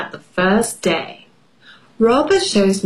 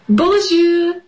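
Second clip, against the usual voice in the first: bright greeting; 9 semitones higher than usual. Acted. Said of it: "I say in the book nook".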